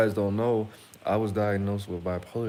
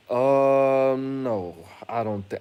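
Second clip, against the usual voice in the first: long, loud drawn-out um, then no; objecting; 4 semitones higher, 9 dB louder, much slower than usual. Retold or imitated. Patient answering a doctor's bipolar diagnosis, doubtful objection. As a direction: stall on a big loud um before disagreeing. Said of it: "And I was like".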